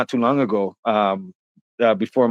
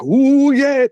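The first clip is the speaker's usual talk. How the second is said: loose tipsy whoop; 13 semitones higher, 5 dB louder than usual. Retold or imitated. Imitating tipsy friend loosening up.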